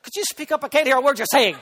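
loud, high-pitched hammed-up complaint; mock exasperated shout. Mock reply to the soft talker.

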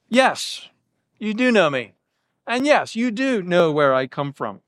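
firm stress on "do" in "you do know", falling tune; sincere yes, "do" stressed and falling; straightforward affirmation. Shown as a demonstration. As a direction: agree plainly, lean on "do". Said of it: "a straightforward affirmation".

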